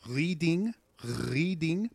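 French-accented "reading", "writing", "arithmetic", R scraped in the throat; slow "reading" with a rising, throaty French R. Shown as a demonstration. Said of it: "what you use to make that, uh, R".

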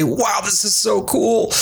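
high, slow, thrilled exclamation; delighted wow. Retold or imitated. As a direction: marvel at the good news. Delighted quote of own reaction.

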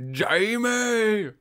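high drawn-out call of Jamie; movie Jamie call. Retold or imitated. Second Jamie impression.

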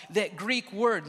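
normal talking voice

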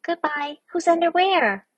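high, tiny piping voice; squeaky little voice. Acted. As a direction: pipe up like a small muffled creature. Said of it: "A muffled little voice piped up".

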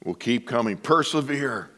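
ordinary delivery

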